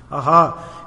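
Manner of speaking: ordinary talk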